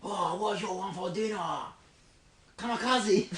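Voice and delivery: Japanese accent. Shown as a demonstration. Accent game with friends, mock Japanese accent. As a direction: put on a caricature Japanese accent. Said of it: "Japanese now?".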